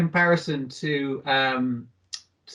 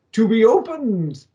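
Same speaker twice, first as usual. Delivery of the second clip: high, slow dramatic declaration; hammed-up reveal; theatrical proclamation; 7 semitones higher, 4 dB louder, slower than usual. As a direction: draw it out grandly like a showy thief. Acting the thief's riddle answer.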